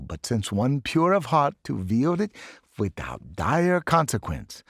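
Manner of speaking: put-on professor in a lightly done German accent; bad German accent. Professor shows the sword to his class, German-accented. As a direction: lecture with a theatrical German lilt. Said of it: "bad accent".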